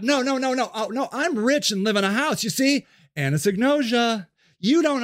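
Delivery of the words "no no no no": ordinary talk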